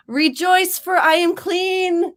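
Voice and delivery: loud, high, triumphant announcement; mock proclamation. Host hails being clean, loud mock-grand proclamation. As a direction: proclaim it grandly, drawing out the end.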